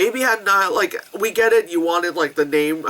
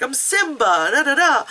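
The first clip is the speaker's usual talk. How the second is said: eager, higher smiling voice; smiling excitement; 7 semitones higher than usual. Retold or imitated. Actor delighting in his Simba lines, smiling excitement. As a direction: imitate an actor thrilled with the role. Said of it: "he's like, smiling".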